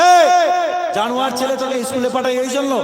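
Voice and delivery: mock scolding, pitched high; angry mother. Mother scolds son for asking about a swear word, angry. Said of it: "ওর মা একটু রেগে গেছে".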